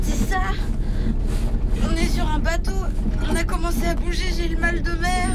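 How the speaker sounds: nauseous panic. Acted. Seasick panic building.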